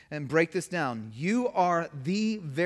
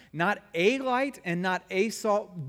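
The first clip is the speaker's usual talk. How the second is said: ordinary talk, nothing marked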